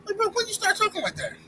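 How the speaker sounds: mock incredulous. Mocking LeBron's changed dialect.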